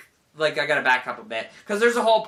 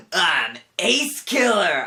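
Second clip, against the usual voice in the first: loud, slow, drawn-out self-introduction; grand villain announcement; 5 semitones higher, 5 dB louder, much slower than usual. Retold or imitated. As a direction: proclaim the villain's name with weight. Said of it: "he finally announces his presence".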